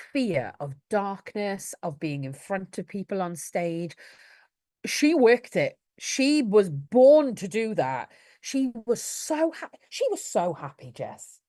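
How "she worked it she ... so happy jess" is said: loud, slow proud praise; proud and excited. Real, not acted. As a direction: burst with pride for her. Proud of child on stage.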